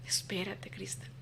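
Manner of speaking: soft secretive whisper; hushed confiding whisper to a friend. Retold or imitated.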